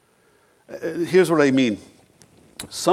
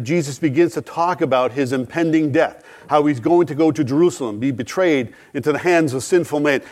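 usual speaking voice

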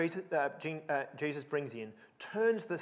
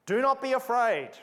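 angel's command; 8 semitones higher, 7 dB louder than usual. Acted. Angel's words quoted to women.

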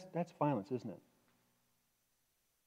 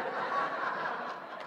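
laugh; 6 dB quieter than usual. Real, not acted. Laughs at his own joke.

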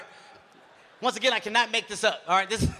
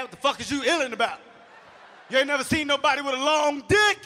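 angry dad; 5 semitones higher, 5 dB louder than usual. Retold or imitated. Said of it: "My dad got mad".

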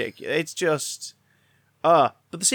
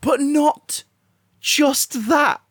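much higher, louder, slow excited near-shriek; overwhelmed with glee; excited outburst; 11 semitones higher, 5 dB louder, slower than usual. Real, not acted.

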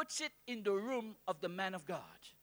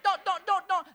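insistent; 8 semitones higher, 8 dB louder than usual. Real, not acted. Preacher insisting.

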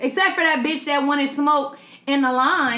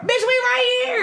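mocking impression; 10 semitones higher than usual. Mocking girl asking her homegirl.

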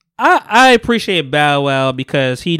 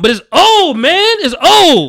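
high, louder jeering repeat; mock taunt; 11 semitones higher, 3 dB louder, slower than usual. Host retelling the diss to friends, high mock jeer. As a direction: sneer it up high like a battle diss. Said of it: "he's like".

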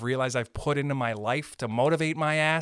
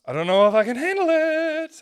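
much higher, louder cry of being overwhelmed; mock overwhelm; 14 semitones higher, 8 dB louder than usual. Comic about too much love, high loud wail.

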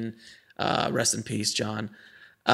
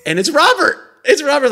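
louder and higher, thrilled; excited disbelief; 13 semitones higher, 11 dB louder than usual. Retold or imitated. Fan hearing his hero's machine pick up, thrilled outburst. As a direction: blurt it out star-struck.

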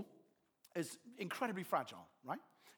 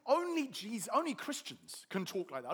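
ordinary talk

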